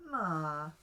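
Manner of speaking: "ma" dropping to a low tone, loud; loud "ma" settling low. Shown as a demonstration. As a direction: say "ma" firmly, sinking low and staying there. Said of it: "You can have a low tone".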